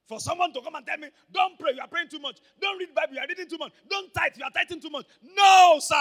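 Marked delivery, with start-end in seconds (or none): 1.3-5.1 loud, high mocking of his critics. Mocking critics who tell him off. 5.2-6.0 very loud, high defiant no; flatly refuse, full force. Real, not acted.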